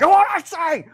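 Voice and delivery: high, loud mock menace; half-joking threat; mock threatening. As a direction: play a hammed-up threatening boss. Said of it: "really threatening with you guys".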